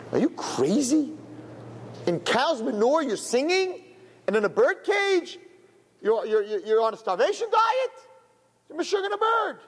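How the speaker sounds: high, disbelieving cry at the bird; a bird expert shrieking at a crazy bird; incredulous outburst. Acted. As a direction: shoot up high in utter disbelief.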